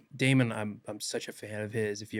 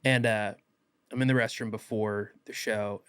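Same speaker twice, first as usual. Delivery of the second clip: usual speaking voice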